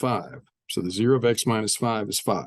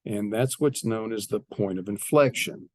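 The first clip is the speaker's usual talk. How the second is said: ordinary talk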